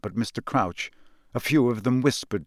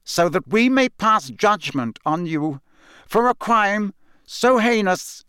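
stern judge; 8 semitones higher than usual. A narrator's reading. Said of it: "he said clearly".

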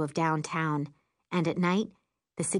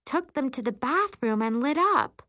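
higher, lively friend confessing a mishap; lightly voiced friend; 8 semitones higher than usual. Friend explaining her crazy act at camp, lightly voiced. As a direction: tell it as an animated, higher-pitched friend.

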